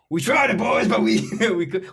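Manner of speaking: hammed-up high, loud team sign-off, laughing; mock rallying cry. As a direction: call it out like a defeated captain, half laughing. Mock sign-off joke about loss.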